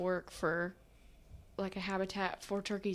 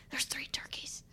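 whispering; 4 semitones higher, 10 dB quieter than usual. Retold or imitated. Whispering about turkeys in yard.

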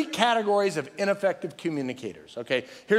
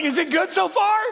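mock anxious shout; 12 semitones higher, 5 dB louder than usual. Pastor mocks himself asking mid-sermon.

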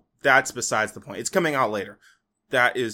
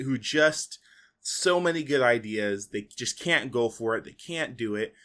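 ordinary talk

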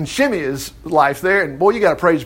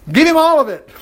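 higher, insistent exclamation; raised-voice insistence; emphatic refusal; 8 semitones higher, 4 dB louder than usual. Acted. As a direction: blurt it out, pitched up. Acted magnanimous reply to the king.

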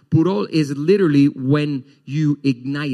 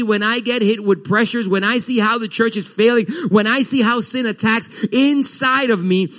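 high, intense preaching of Paul's words; fiery preaching; 7 semitones higher, 3 dB louder than usual. Acted. Pastor voicing Paul to the church, fiery and urgent. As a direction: preach it with rising passion.

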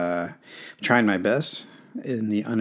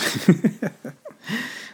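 short high giggle; embarrassed little laugh; 12 semitones higher than usual. Real, not acted. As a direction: laugh it off lightly. Laughs after apologizing.

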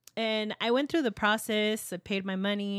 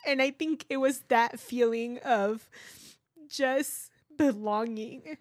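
slow, emotional recollection near tears; tearful; 3 semitones higher, slower than usual. Real, not acted. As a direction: draw it out, moved and fragile. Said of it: "I cry every time I tell this story".

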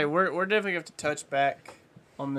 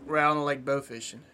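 ordinary talk, nothing marked

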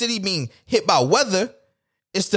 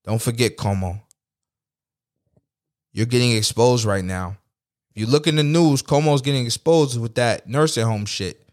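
low, measured warning; calling someone out; 8 semitones lower than usual. Real, not acted. Host warns the governor he's being exposed, low and accusing. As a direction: put them on notice in a much lower voice. Addresses Cuomo directly, calling him out.

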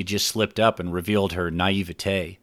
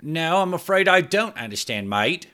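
higher, breezy Cockney voice; Rudo's stand-in in Cockney, feigning ignorance; Cockney accent; 9 semitones higher than usual. Acted. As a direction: play innocent in Cockney. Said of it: "not even trying to cover up her Cockney accent".

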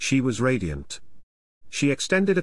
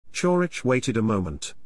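ordinary delivery, nothing marked

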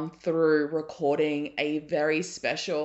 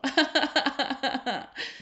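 high, choked laugh through tears; teary laugh; 9 semitones higher than usual. Real, not acted. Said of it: "like, laughing and crying".

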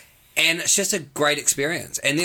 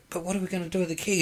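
fast, softer, higher puzzled question; quick baffled question; 7 semitones higher, 6 dB quieter, much faster than usual. Retold or imitated. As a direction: rattle it off, confused. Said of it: "I was like".

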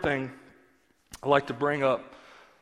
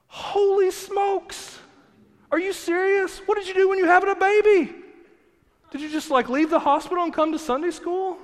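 much higher, incredulous put-on voice; shocked disbelief; 13 semitones higher, 4 dB louder than usual. Pastor mocking his own shock at the ladies' pins, high and incredulous. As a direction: play up the shock, pitched way up.

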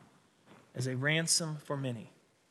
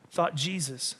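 ordinary talk, nothing marked

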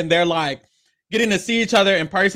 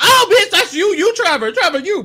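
high, loud and laughing, calling him out; a laughing mock shriek of recognition; excited laughing call-out; 11 semitones higher, 5 dB louder than usual. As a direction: squeal it through a laugh like you just caught him. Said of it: "And they was like".

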